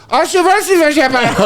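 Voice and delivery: very high-pitched gibberish imitation of Dutch; mock Dutch babble. Stranger answering a lost festival-goer, high mock-Dutch babble. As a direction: squawk fast nonsense as mock Dutch. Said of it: "they're speaking Dutch".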